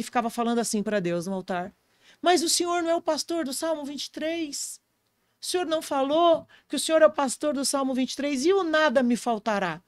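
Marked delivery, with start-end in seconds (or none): 2.2-9.8 imitated tearful prayer. Father crying out to God at the altar, imitated tearful high plea. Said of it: "E ele chorava".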